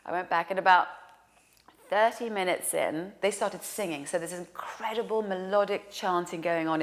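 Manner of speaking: ordinary talk, nothing marked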